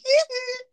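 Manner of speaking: high squealing hee hee. Shown as a demonstration. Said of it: "I sound like [name]".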